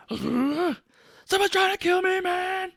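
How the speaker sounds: hammed-up hysterical man; panicked Cage impression. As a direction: shriek it like a paranoid wreck. Imitating Cage's panicked line.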